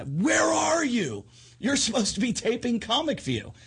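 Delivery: high-pitched, exasperated mock yell; imitated frantic caller. Caller chasing a comic who missed his taping, exasperated yell. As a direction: cry it out high, stretching the question. Acting out an alarmed phone caller.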